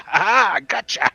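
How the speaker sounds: pleased play-acted cry of victory; acted triumph. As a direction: crow it in playful triumph. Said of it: "You're like".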